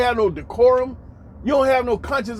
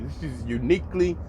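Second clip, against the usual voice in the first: ordinary delivery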